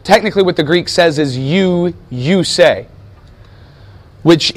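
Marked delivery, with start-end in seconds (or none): 1.4-2.9 "You" repeated and leaned on; emphasis landing on "you"; stress on YOU. Shown as a demonstration. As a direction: hit the word "you", then let "say" fall. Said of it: "emphasizing the word you".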